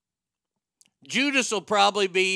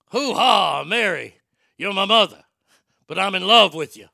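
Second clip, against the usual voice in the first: much louder booming Pacino declaration; Pacino as Jesus; 9 dB louder than usual. Acted. Pacino's Jesus confessing love to Mary, booming and hammed-up.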